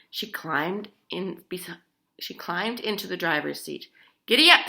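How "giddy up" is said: loud, higher giddy up; Grandma driving the gold coach; shouted giddy up. A narrator's reading. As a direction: yell it to spur the horses. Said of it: "she cried".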